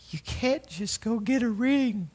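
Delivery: put-on girlfriend's voice. Retelling Sherry's words at Cracker Barrel, high and slow. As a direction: mimic her hurt protest. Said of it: "And she goes".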